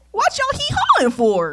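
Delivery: high, slow hammed-up country twang; mock the country folk in their own drawl; mock Southern accent. Continues mocking Georgia relatives' accent.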